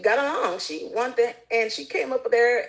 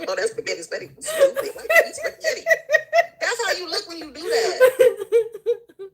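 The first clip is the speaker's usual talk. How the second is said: much higher squeal through laughter; laughing mockery; 11 semitones higher, 3 dB louder than usual. Mocking the critic, squealing high through laughter. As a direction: squeak it out cracking up.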